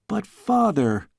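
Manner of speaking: put-on a girl's drawn-out protest; exasperated cry. A narrator's reading. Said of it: "she cried in exasperation".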